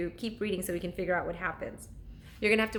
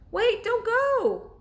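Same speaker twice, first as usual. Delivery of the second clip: high, loud, slow call after them; girl calling after swimming dolphins; 14 semitones higher, 8 dB louder, much slower than usual. Acted. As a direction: plead with the leaving dolphins. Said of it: "called Annie".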